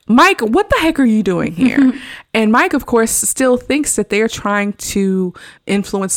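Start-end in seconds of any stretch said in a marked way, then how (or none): 0.1-1.9 surprised. Retold or imitated. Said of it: "And they're like".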